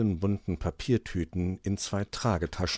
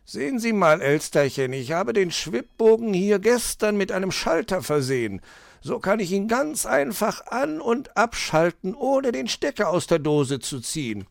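higher fox voice, eager and proud; proud fox explaining; 12 semitones higher, 5 dB louder than usual. Acted. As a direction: show off the new switch. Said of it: "erklärte er".

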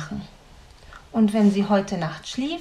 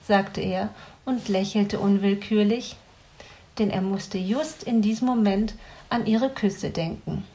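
usual speaking voice

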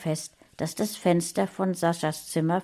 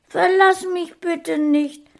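hoch und laut flehend; schluchzend; 13 semitones higher, 7 dB louder than usual. A narrator's reading. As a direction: fleh sie an, den Tränen nah. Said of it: "schluchzte Sascha". (flehende Kinderstimme)

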